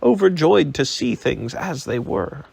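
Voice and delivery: plain reading voice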